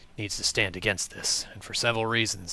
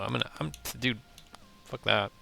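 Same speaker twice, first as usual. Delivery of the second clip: ordinary delivery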